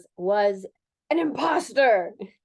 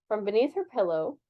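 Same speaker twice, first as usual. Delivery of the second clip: ordinary delivery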